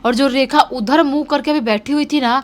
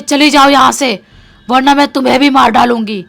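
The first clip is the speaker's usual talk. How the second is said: a heavy, louder possessed threat; 9 dB louder than usual. Retold or imitated. Possessed girl threatens the landlady, heavy menacing voice. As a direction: menace her like something inhuman. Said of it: "एकदम भारी आवाज़ में बोलती है".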